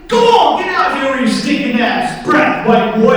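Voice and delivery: loud, high-pitched dismissal; acted holler, sending the victim packing; mugger yelling. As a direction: shout him off the street. Mugger yells the victim away.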